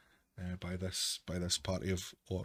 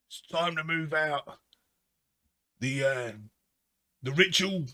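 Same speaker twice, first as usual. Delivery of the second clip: barking orc captain; 4 semitones higher than usual. Acted. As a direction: bark commands at your soldiers. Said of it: "this orc voice barking at his".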